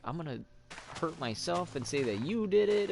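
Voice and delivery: high, loud mock threat; freaked-out wife. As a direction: squeal it like a hysterical wife. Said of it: "freaked out to the point where".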